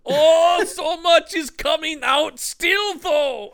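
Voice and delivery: high, loud, slow wail; high panicked shout. Pretend. Voicing sick villain.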